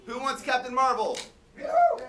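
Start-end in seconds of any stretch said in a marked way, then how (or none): 1.6-2.1 high whooping cheer, louder; excited cheer. Acted. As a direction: whoop it up for the crowd. Host cheers for Captain Marvel.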